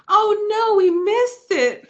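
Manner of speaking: high, slow, wailing mock dismay; disappointed onlooker. A late bystander missing the proposal, high mock wail. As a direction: whine like a stranger who missed the moment. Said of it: "all I hear is someone goes".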